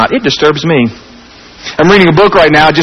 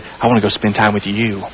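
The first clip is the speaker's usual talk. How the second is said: tender invitation; 8 semitones lower, 8 dB quieter than usual. Retold or imitated.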